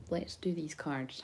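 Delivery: normal talking voice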